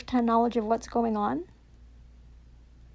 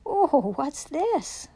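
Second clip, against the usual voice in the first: mock surprise; 3 semitones higher, much slower than usual. Real, not acted.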